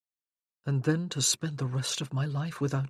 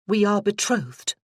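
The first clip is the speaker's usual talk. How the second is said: firm, louder declaration; firmly; 4 semitones higher, 6 dB louder than usual. Acted. Freya declares to Josh they are engaged, firm and louder. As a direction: plant it like a decision. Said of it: "she said firmly".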